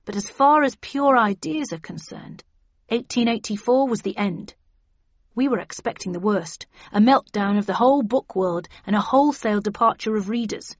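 ordinary delivery

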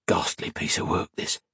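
unvoiced, soft mutter. A narrator's reading. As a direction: mutter it in disgust. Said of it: "as he muttered".

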